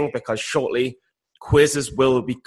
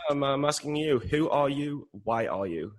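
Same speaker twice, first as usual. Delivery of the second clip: quiet mock gravity; 8 dB quieter, slower than usual. Real, not acted. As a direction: ponder it quietly.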